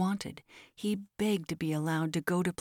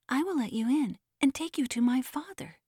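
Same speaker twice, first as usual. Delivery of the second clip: put-on child's offer; 7 semitones higher than usual. A narrator's reading. Child offers help to the pilgrim.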